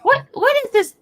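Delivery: high startled outburst; surprised. Real, not acted. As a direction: jump up high in shock.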